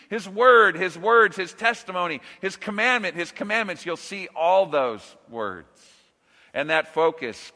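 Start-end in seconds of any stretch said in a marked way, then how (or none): none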